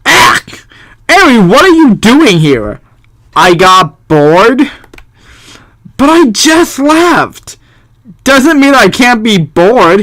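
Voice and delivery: hint of a bored girl; bored daughter. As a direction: play the cheeky kid. Voicing daughter and dad.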